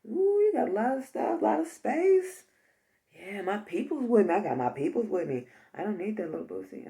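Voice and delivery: high-pitched, casual slangy mouse; mouse crew member. Pretend. Mouse to its crew on finding a roomy house, high slangy role voice. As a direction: play an impressed mouse checking out the place. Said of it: "So they brought their crew. It was like".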